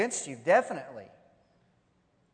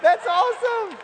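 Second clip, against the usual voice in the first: loud, high, elated exclamation; real delight; 14 semitones higher, 8 dB louder than usual. Real, not acted. Preacher caps his own story, delighted. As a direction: burst out delighted at the punchline.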